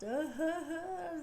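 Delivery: high, drawn-out imitation sob; put-on crying sound; mock crying. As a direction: wail a pretend boo-hoo. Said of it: "start crying".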